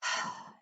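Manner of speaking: audible breathy exhale; whooshing voiceless sigh; deep sigh. Shown as a demonstration. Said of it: "letting out a deep breath".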